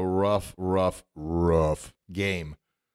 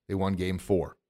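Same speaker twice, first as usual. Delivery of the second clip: normal talking voice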